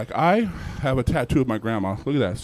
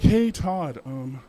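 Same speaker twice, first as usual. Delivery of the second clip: innocent polite ask; 6 semitones higher than usual. Retold or imitated. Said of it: "they're like".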